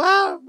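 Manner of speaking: high, hammed-up shriek of the mother; mock mom yell. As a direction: imitate the mom screaming. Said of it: "la doña así como". (imitación del grito de la doña)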